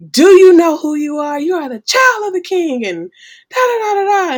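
loud, high preaching yell. Retold or imitated. Mother to her crying daughter, a loud high yell. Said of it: "she yelled and said".

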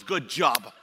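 deflated, quiet, unimpressed cheer; limp good job. Retold or imitated. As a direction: mumble it with no enthusiasm. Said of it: "everybody in Germantown goes".

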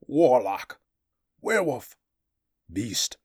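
loud, high spat curses; frightened barbarian. Acted. Thord hurls names at the beast before he springs. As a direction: spit the names at him in fear.

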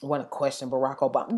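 put-on low, soft stab at broken-English accent; half-hearted foreign-accent imitation; Melania's accent. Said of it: "her broken English bullshit she does".

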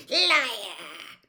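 loud, drawn-out angry exclamation; breathed accusation. A narrator's reading. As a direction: fling it out as the cold master. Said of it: "breathed the second voice".

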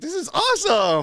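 high, slow doggy delight. Acted. Voicing dog enjoying its scolding, high delighted voice.

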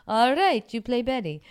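mock Italian director offering the part; Dario's accent. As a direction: casually cast her in broken English. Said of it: "And he goes".

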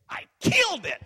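high, explosive shouted brag; mock boast. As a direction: shout it as an over-the-top victory boast.

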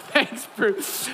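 usual speaking voice

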